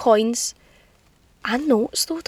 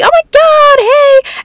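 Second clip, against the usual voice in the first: squealing high and loud; excited high greeting; 6 dB louder, much slower than usual. Retold or imitated. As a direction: gush like meeting a new friend. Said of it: "They're like".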